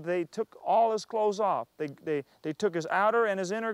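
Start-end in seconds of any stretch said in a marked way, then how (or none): none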